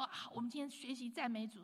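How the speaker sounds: ordinary talk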